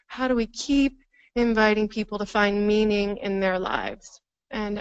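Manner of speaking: normal talking voice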